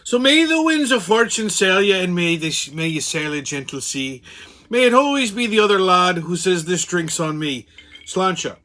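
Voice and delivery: stage-Irish toastmaster's brogue; Irish toast. Pretend. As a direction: give a lilting toast in an Irish accent. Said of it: "a little Irish toast".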